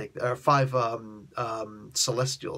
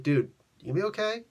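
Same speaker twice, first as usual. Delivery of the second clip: mock concern; 7 semitones higher, faster than usual. Galactus checks on stricken Surfer.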